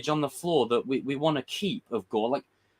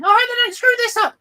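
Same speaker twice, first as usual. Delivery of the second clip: much higher, louder, quicker squeaky worry; tiny high voice; 8 dB louder, faster than usual. Retold or imitated. His little inner voice fretting over Marvel, high and squeaky. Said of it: "a little voice going".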